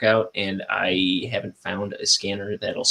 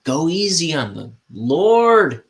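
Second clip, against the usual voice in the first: mock preacher; 6 semitones higher, 4 dB louder, slower than usual. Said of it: "it's like".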